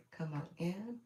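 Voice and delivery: ordinary talk, nothing marked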